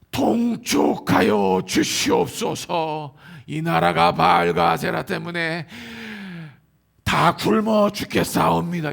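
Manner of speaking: slow, loud archaic plea to the king; courtier pleading. Pretend. Prophet begging the king to act, slow loud court plea. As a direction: beg the king in old court style, drawn out.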